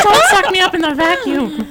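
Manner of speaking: high, loud plea breaking into laughter; mock cartoon plea. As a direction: squeal it as a pretend panic, laughing. Acting polar bear cafe scene.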